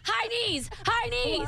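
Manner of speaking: high shouted drill call; drill sergeant. Pretend. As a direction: call out reps like a coach. Barking workout orders.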